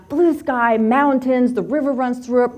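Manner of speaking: ordinary talk, nothing marked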